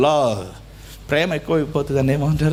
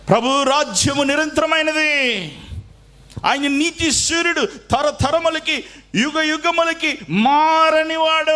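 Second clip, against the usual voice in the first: high, slow, ringing proclamation; preaching at full force; 10 semitones higher, slower than usual. Acted. Pastor proclaiming to the congregation, high and slow. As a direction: lift it up high and slow for the church. Preaching climax on God's eternal kingdom.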